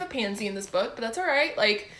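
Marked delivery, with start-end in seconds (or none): none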